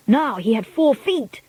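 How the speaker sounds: ordinary delivery, nothing marked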